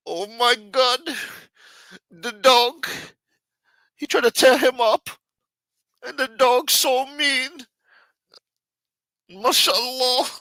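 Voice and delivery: very high, loud Pakistani accent impression; mock Pakistani accent. Shown as a demonstration. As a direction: wail it in an exaggerated Pakistani accent, drawing out the ends. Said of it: "That's my Pakistani accent".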